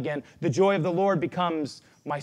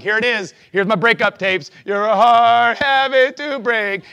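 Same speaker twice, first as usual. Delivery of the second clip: prayer confession; 5 semitones higher, 9 dB louder, slower than usual. Retold or imitated. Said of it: "I'm like".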